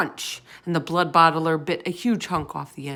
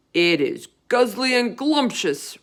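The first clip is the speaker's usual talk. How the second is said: higher, louder greedy giant shout; 7 semitones higher, 8 dB louder than usual. A narrator's reading. Bloodbottler defending eating humans, in a loud greedy shout. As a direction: bellow it with relish. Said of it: "shouted the blood bottler".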